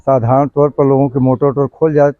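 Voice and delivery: ordinary delivery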